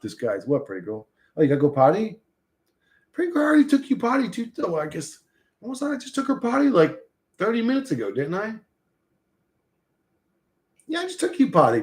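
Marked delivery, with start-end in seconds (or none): 3.1-11.8 high quick dog-talk voice; pet talk. Real, not acted.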